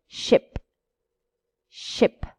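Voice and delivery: ship with a quick short ɪ. Shown as a demonstration. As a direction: clip the i in ship. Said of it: "Let's see the difference".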